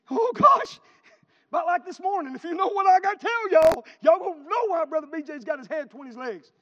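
loud, high preacher's cry; preaching at full force. Real, not acted. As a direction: ring it out to the whole church.

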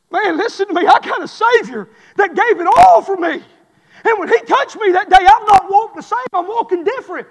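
loud, high preacher's shout; full-force preaching. Acted. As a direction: preach it out to the rafters.

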